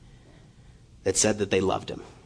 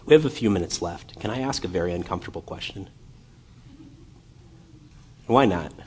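usual speaking voice

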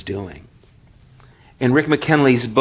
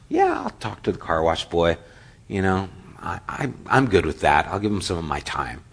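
lower, softer, indulgent muttered thought; condescending inner thought; 5 semitones lower, 5 dB quieter than usual. Retold or imitated. Clint privately humouring the car wash boy, lower and softer. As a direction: humour the kid under your breath.

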